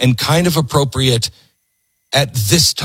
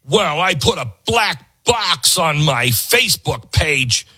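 higher, slower mock-proud voice; mock smug activist; 6 semitones higher than usual. Mocking an online activist, higher smug brag. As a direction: play a self-satisfied poster bragging. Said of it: "What are you doing to build the country?".

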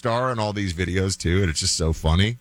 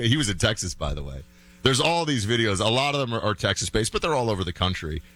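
usual speaking voice

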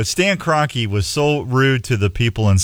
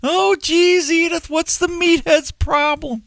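high put-on Archie Bunker voice; gruff Archie griping at Edith. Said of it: "That was a little better".

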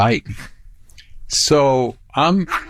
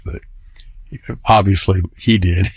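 usual speaking voice

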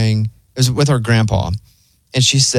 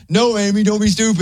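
higher, lightly retold telling-off; a hint of shouting at Amy; mock yelling; 7 semitones higher than usual. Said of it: "yelling all the time".

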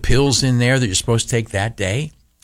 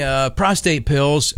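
usual speaking voice

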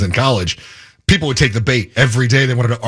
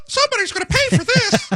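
very high falsetto cartoon mouse voice; Mickey. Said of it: "that's Mickey".

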